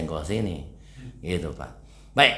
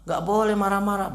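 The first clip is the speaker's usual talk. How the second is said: put-on parent admonishing child; 9 semitones higher than usual. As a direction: play a parent telling a child to behave, voice raised high. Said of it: "Orang tuanya nyuruh".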